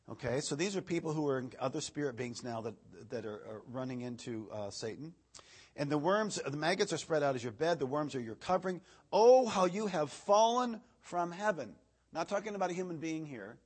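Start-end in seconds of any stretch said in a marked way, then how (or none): 5.8-11.7 raised, declaiming pulpit reading voice; scripture reading. Acted. Preacher reading Isaiah to the congregation, declaiming. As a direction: proclaim the verse to the room.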